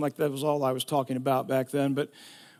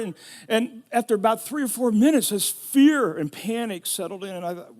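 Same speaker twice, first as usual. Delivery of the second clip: normal talking voice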